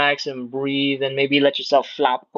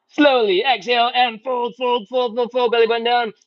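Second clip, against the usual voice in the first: straining mid-stretch; 9 semitones higher than usual. Real, not acted. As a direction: talk through the effort of the fold. Coach folding along with class.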